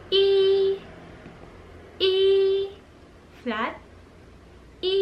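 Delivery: E held level and high, first tone; flat first tone. Shown as a demonstration. Teacher showing the flat first tone. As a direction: keep E dead flat like a held note. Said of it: "First tone is a flat tone".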